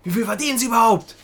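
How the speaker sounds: high, loud mock demand; aggressive clerk. Clerk demanding the trainee's salary, loud indignant imitation. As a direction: mimic a clerk losing patience. Acted clerk escalating the quarrel.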